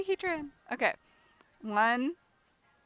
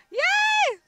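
shrieking jackpot winner; 8 dB louder than usual. Real, not acted. Shiny Heatran found.

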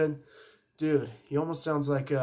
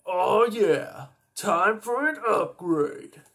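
alien voice; 5 semitones higher, 4 dB louder, slower than usual. Acted. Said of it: "Upgrade is one of my favorite".

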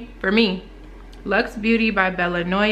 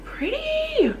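gushing; 3 dB quieter than usual. Real, not acted. Admiring the nails.

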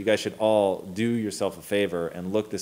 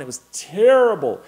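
emphatic, higher and louder on terrible; exasperated; 7 semitones higher, 7 dB louder than usual. Retold or imitated. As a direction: exclaim it in exasperated disbelief. Exasperated verdict on awful comics.

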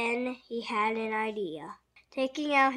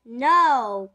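very loud, long no; emphatic cry; 12 dB louder than usual. A narrator's reading. Jake answering his family, very loud cry. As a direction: cry out in hearty denial. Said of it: "cried Jake".

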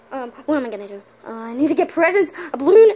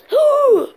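very high loud gasp with a laugh; big gasp; 12 semitones higher, 6 dB louder than usual. Acted. Realizing the date, a loud high gasp. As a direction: gasp in thrilled alarm.

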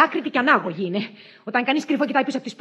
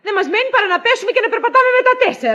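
fast, loud, high complaint; exasperated rant; 9 semitones higher, 5 dB louder, faster than usual. Acted. Wife objects to the barking name, ranting loudly. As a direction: rattle it out in loud indignation.

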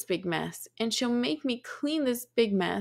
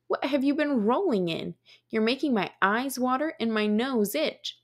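ordinary delivery, nothing marked

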